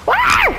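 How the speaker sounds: sharp high scream. Real, not acted. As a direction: yell as the enemy lunges. Combat fright.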